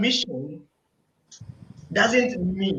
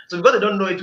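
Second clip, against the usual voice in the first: ordinary talk, nothing marked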